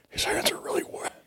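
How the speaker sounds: very soft, voiceless aside; whisper. Acted. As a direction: whisper it secretly into a friend's ear. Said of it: "I whisper, I whisper into Lester's ear".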